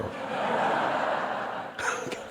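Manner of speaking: soft breathy laugh at the punchline; laughing at the dialect pun; hearty laugh. Real, not acted. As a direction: laugh off the joke. Audience-style laugh at joke.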